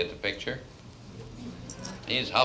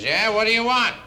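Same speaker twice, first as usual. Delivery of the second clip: high, loud, grumpy lodge owner; irritated shout; 13 semitones higher, 7 dB louder than usual. Acted. Lodge owner woken by wives knocking at night, irritated. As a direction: demand it loudly, annoyed at being woken.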